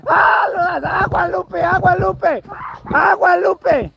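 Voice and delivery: high-pitched, drawn-out warning shouts; urgent warning cry. Real, not acted. Urgent warning as Lupe drives. (grito agudo de advertencia)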